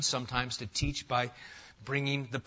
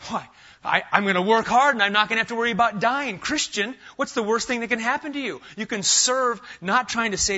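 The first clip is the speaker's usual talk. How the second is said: louder, building pulpit cadence; full-force preaching; 6 semitones higher, 5 dB louder than usual. Acted. Pastor rousing his church on assurance, fervent preaching. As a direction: preach it out to the back row.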